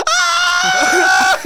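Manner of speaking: high mock scream of alarm. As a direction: shriek like a panicked mother. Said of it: "She starts screaming".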